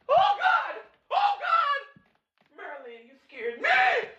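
high, panicked cries; frightened shouting. Real, not acted. Yelling at the dog that scared her, high and panicked.

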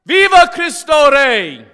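high, slow, full-force acclamation; triumphant shout. Real, not acted. Preacher's closing acclamation to the congregation, triumphant shout. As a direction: cry it out to the whole crowd.

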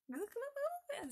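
high, drawn-out playful glugging; gurgling noise. Acted. Storyteller imitates burrowing creatures for children, playful gurgling.

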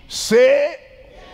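high and loud, a fervent plea; exhortation. Real, not acted. Preacher urging listeners, fervent loud plea.

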